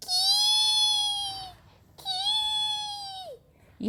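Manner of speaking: high thin "ki" chirp; cricket chirp imitation. Girl imitating a cricket's chirp. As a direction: squeak it out like a cricket.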